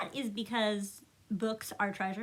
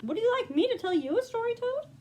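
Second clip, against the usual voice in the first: eager Frog; 10 semitones higher, faster than usual. Acted.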